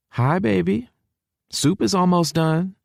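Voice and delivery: high-pitched motherly southern voice; southern mama. A narrator's reading. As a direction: greet your son as a high-voiced Texan mom. Mama greets her son.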